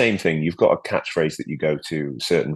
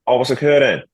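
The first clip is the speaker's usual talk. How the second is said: higher, louder Welsh catchphrase; Nessa; 6 semitones higher, 5 dB louder than usual. Acted. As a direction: belt it out in broad Welsh. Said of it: "Well, that's Nessa".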